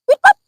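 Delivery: high, loud imitated car horn "ปิ๊บปั๊บ"; car horn beep. Grandma mimics the passing car honking at her, loud and high.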